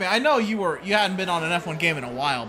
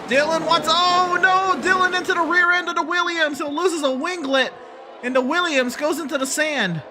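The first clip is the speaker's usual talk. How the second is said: motorsport commentator; 6 semitones higher, slower than usual. Acted. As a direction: build up the crash call live. Live race play-by-play.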